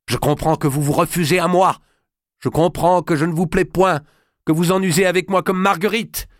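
high, loud wounded anger; bitter accusation. Acted. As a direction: hurl the accusation. Jealous king accuses wife. (reproche jaloux et criant)

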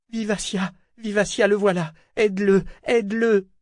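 high-pitched desperate call for help; anxious, pleading cry; urgent plea. A narrator's reading.

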